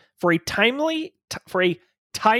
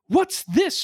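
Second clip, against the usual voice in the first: sharp, high demand; mock indignant, much higher; an indignant secretary confronts her boss; 10 semitones higher than usual. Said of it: "she demands".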